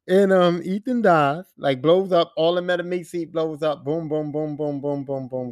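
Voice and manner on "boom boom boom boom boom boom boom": soft, low run of booms; fading imitated explosions. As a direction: let each blast sink lower and softer. Imitating explosions as game ends.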